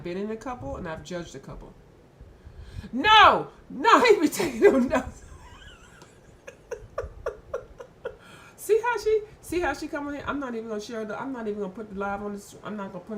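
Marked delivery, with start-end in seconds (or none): 2.9-6.8 loud laughing no; laughing protest. Real, not acted. 8.6-10.2 excited. Real, not acted. Laughing at a viewer's comment.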